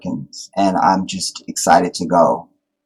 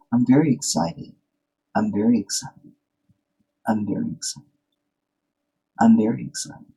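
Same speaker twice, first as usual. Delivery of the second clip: much softer, flat mock "I'm very excited"; fake excitement like his wife; 9 dB quieter than usual. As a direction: imitate the wife's unconvinced, quiet excitement. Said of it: "in the fake way of my wife".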